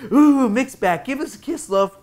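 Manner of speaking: high-pitched mock-flirty coo; playful, put-on swooning greeting; teasing. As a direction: tease him in a high comic voice. Paul teases Mick.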